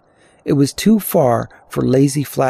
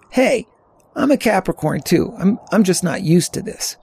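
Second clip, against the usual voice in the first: high-pitched protesting youngster; defensive teenager; 7 semitones higher than usual. A narrator's reading. Teen answering older hiker's teasing, high and defensive.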